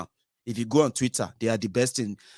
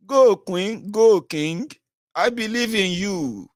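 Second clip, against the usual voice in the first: mock cheering; 6 semitones higher, 4 dB louder, much slower than usual. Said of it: "I say".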